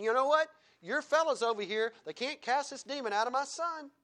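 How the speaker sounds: indignant retold accusation; confronting father. Father confronts Jesus in retold scene.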